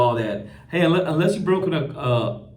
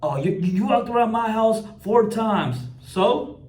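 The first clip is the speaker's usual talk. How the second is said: mocking the homeowner; 7 semitones higher than usual. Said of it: "mocking the homeowner's words".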